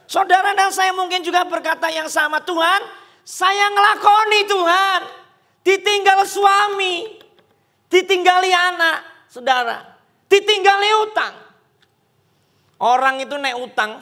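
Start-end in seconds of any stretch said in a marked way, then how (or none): 0.1-11.3 impassioned preaching. Acted. Preaches at full force.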